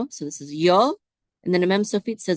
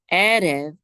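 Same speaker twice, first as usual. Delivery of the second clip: Erev stressed on the opening E; first-syllable stress; 4 dB louder than usual. Shown as a demonstration. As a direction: lean on the E that begins Erev. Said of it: "accent mark is on the E in the beginning".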